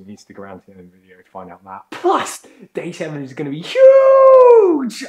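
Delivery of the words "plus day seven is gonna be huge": loud hyped announcement; excited shout. Real, not acted. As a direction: pump up the audience. Hyping the big finale.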